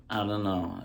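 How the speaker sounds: ordinary delivery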